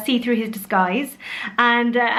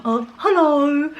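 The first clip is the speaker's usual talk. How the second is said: slow, higher silly bear voice; Norman; 4 semitones higher, slower than usual. Acted. Mum repeating her bear voice, silly drawn-out greeting. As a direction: drawl a comic greeting in character. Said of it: "why Norman talks like that".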